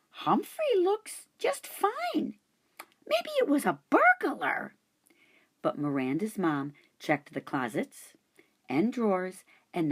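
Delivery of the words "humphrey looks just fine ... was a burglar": put-on much higher, puzzled girl voice; a confused little girl; young girl voice. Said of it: "said Miranda".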